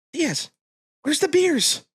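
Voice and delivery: high-strung buddy. Retold or imitated. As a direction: squeal it like a nervous friend waiting in the car. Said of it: "He's a little high strung".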